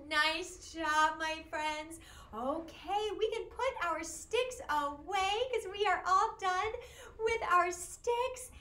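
ordinary talk

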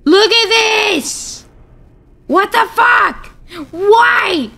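high, drawn-out cries of amazement; shocked disbelief. Real, not acted. Gamer discovers an outside area, high incredulous shouting. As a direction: exclaim it slowly in high-pitched disbelief.

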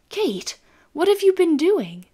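high, loud alarmed outburst; cried out. A narrator's reading. Husband returns to find the gold traded, loud and high. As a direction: shout it in shock at the house full of plates. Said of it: "he cried out".

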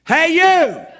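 high, booming call from behind; loud holler. Acted. Rude passenger calls after man in busy airport, loud holler. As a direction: yell it to stop someone across a concourse. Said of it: "I hear this loud voice say".

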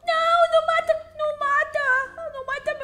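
high, loud crying plea; pleading cry. Acted. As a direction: beg them not to kill it. Begging for the piglet.

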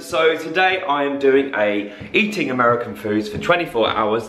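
ordinary talk, nothing marked

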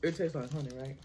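chewing while talking; talking between bites of muffin; mouth full. Real, not acted. Seen 3: Woman ate cornbread muffin just before; mouth working, hand to mouth.

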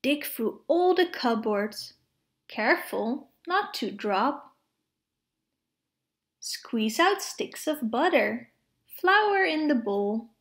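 usual speaking voice

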